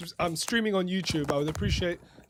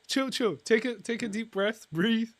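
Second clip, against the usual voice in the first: urgent calming; 5 semitones higher, 5 dB louder than usual. Real, not acted. Calming an agitated caller.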